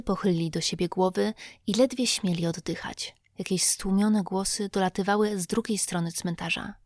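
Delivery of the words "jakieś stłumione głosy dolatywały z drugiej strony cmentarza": usual speaking voice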